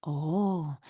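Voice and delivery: ordinary talk, nothing marked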